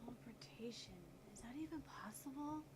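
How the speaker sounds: whispered mutter, soft and breathy; muttering to herself. Acted. Puzzling over the result, whispering to herself. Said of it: "as she mutters to herself".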